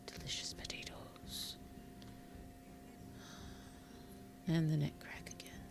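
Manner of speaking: hushed whisper. Real, not acted. Whispered aside while checking the potatoes. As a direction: breathe the words out.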